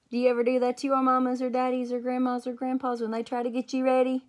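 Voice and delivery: put-on bright, lively aside to the listening children; playful question to kids. Reader asking the children a cheeky question. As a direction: ask the little ones with a grin.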